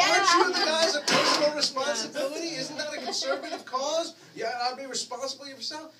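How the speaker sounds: high, loud, mocking challenge; sarcastic jab. Teasing the crew about personal responsibility, loud sarcastic jab. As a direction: needle them with sarcasm.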